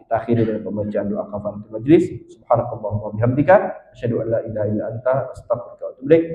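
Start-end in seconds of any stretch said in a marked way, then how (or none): 2.3-6.2 low, quick recitation; recited closing prayer. Real, not acted. Preacher closes the gathering with kafaratul majlis, quick low recitation. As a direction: run softly through the memorized prayer.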